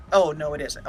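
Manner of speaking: ordinary talk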